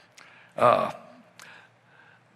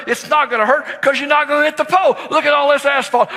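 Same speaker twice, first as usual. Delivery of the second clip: fast, excited insisting; 10 semitones higher, 3 dB louder, faster than usual. Retold or imitated. Dad insisting, rushing.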